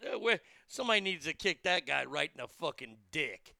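ordinary talk, nothing marked

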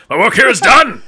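high, loud gloating villain; smug villain line. Acted. Villain gloating to his captive. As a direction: gloat over the finished job.

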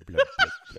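high squeaky fish babble; catfish voice. Pretend. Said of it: "that's the catfish's voice".

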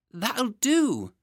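put-on high, squirming child protest; a wriggling boy pushing the nurse away; whiny small boy.

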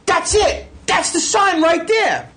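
high, excited accusing shout; retold screaming discovery, pitch way up; yelling and screaming. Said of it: "as I'm yelling and screaming".

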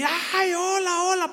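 high, slow squealing ¡Ay, ya vienen! with a laugh; high-pitched mocking fangirl squeal; giddy fan squeal. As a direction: mimic an overexcited fan spotting celebrities. Imitating excited fans.

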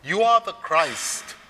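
a loud slow proclamation; crying out. Retold or imitated. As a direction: cry it out with conviction. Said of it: "cries out".

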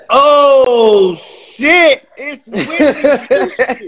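starstruck surprise. Retold or imitated. The cast seeing [name] come around the corner, starstruck high voice. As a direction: gasp it out starstruck.

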